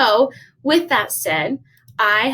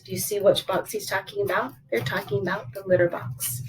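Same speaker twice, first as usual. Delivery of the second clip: ordinary delivery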